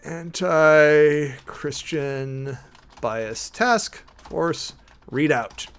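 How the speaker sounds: very slow, drawn-out words; dictating while typing. Real, not acted. To viewers while typing the task force readout, very slow dictation. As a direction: spell out the calendar entry as you type it. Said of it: "I am putting on my calendar as we speak".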